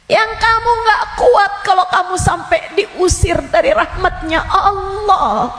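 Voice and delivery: high, loud warning about the grave; fiery preaching. Acted. The ustazah warns her listeners, loud and fiery. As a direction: preach it out, rising to a shout. Preaching at full force on death.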